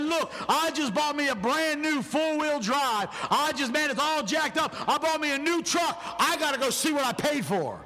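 excuse-making truck owner. Acted. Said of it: "This guy said".

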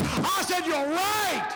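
high, exultant shouted preaching; full-force preaching. Real, not acted. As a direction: cry it out to the congregation, stretching key words.

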